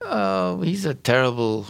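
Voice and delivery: ordinary talk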